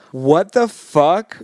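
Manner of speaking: slow stunned what the fuck; mock shock. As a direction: drag it out in disbelief. Retold shocked reaction.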